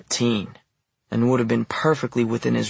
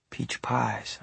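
murmured; 9 dB quieter than usual. Acted. Narrator recalls Ruby's mother at the cafe, murmuring. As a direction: murmur it, half under the breath. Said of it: "I murmured".

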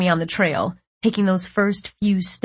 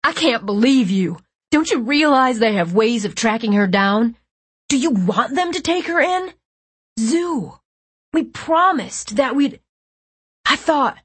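higher, louder distraught rant; furious; 5 semitones higher, 4 dB louder than usual. A narrator's reading. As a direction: spiral from anger to hurt. Brother's rising fury.

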